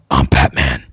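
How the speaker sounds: very low, gruff superhero voice; dark, husky vigilante growl; Batman impression. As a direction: drop to the bottom of your range. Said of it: "the Batman impression".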